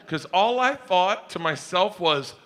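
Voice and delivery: usual speaking voice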